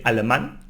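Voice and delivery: alemán stressed on the final "mán"; alemán, accented A emphasized at the end; stress on last syllable. Shown as a demonstration. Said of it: "because we need to stress".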